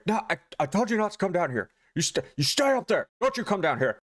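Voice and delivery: imitated irritated husband; pissed off. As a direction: snap at someone to stay away. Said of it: "anything she says just pisses him off".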